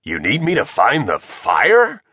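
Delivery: gruff dad puzzling it out, rising; confused question. A narrator's reading. Bob misunderstands the request, confused. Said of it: "Bob asked, confused".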